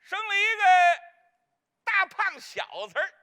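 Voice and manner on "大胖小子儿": words breaking into a laugh. Real, not acted. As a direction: laugh through the line. Laughing at own joke.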